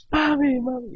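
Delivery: high, loud whimpering call; mock tearful cry. Pastor retells waking his sleeping wife, mock tearful call. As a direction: call out urgently as if in tears. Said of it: "llorando y, y le dije".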